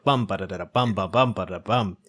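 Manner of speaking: irregular bum-ba-da beats, like a jolting wagon; bumpy-road rhythm. Shown as a demonstration. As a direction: imitate uneven stresses with thudding nonsense syllables. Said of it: "a wagon on a bumpy road".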